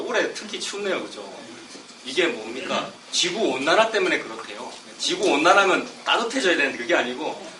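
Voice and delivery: ordinary talk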